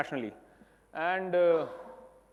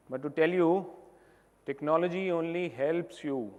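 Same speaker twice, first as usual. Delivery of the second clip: ordinary talk, nothing marked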